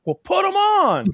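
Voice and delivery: high, slow mock exasperation; fed-up cop. Guest mimicking the cop telling her to dress, slow and exasperated. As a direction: drag it out, fed up.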